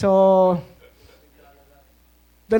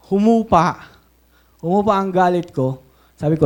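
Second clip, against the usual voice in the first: usual speaking voice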